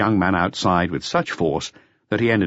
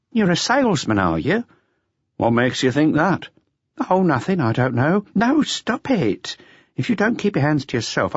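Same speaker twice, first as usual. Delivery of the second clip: higher voice for the waitress and customer; café flirtation in a raised voice; voiced café dialogue; 9 semitones higher than usual. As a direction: play the flirting pair lightly, pitched up.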